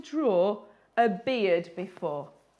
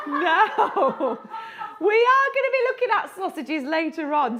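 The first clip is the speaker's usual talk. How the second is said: high and loud, giggles running through; still laughing; 6 semitones higher, 6 dB louder than usual. Real, not acted. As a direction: talk on while laughter fades. Recovering from laughing fit.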